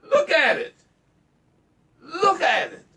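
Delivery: high, loud, near-crying cry out; real anguished outburst; worked up. Real, not acted. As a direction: plead loudly, voice cracking. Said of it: "I'm getting worked up here".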